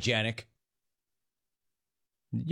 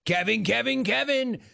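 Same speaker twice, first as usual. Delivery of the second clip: sing-song name call; 9 semitones higher than usual. Acted. Host sings out name again.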